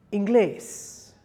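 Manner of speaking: inglés with the final GLÉS strong; in-GLÉS, weight on the end of inglés; accented-syllable stress. Shown as a demonstration. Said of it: "syllable that needs to be stressed".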